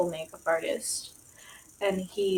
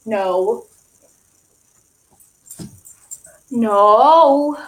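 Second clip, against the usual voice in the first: louder sharp no the second time; firm no; 8 dB louder than usual. Real, not acted. Dog goes for the prop again, scolding no. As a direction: warn the dog off, loud.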